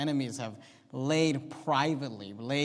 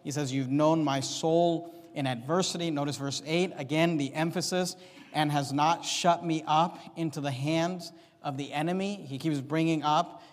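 louder, raised preaching voice with punched key words; emphatic preaching; 3 semitones higher, 6 dB louder than usual. Real, not acted. Preacher driving home David's trust to a congregation, louder emphatic preaching. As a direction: preach the verses out with mounting conviction. Preaching builds over the psalm verses.

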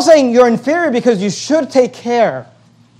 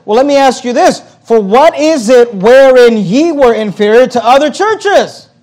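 turning the tables; 4 semitones higher, 6 dB louder than usual. Retold or imitated. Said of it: "he kinda turns the tables".

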